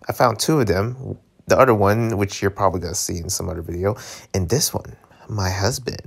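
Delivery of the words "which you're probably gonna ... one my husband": ordinary delivery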